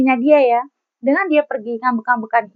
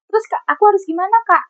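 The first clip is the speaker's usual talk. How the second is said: pleading viewer voice; 7 semitones higher, much faster than usual. Pretend. Viewer voiced asking advice.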